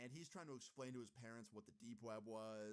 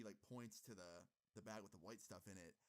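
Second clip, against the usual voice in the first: usual speaking voice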